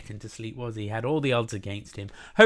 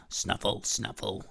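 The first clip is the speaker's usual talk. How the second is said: snuffling hedgehog; 3 semitones lower, 3 dB quieter, slower than usual. Acted. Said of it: "went the hedgehog".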